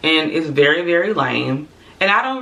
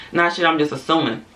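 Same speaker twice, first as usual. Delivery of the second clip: normal talking voice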